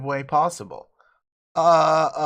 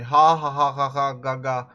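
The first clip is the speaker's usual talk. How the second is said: mock laugh; 3 semitones lower than usual. Mocking the laughter.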